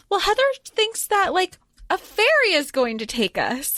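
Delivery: high-pitched acted excuse; retold evasive answer. Vivi explaining what Heather thinks, high retold evasive answer. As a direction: answer high, hedging around the truth.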